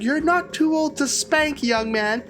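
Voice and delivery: high, slow stern threat; threatening mom. Retold or imitated. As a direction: spell out the threat. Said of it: "She's like".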